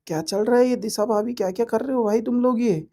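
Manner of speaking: ordinary talk, nothing marked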